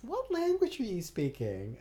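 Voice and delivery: lightly voiced strange lady, drawn out; curious stranger in a bar, slow and high; odd stranger. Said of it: "she was like".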